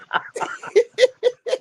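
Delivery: high squealing laugh; high laughing fit. Real, not acted.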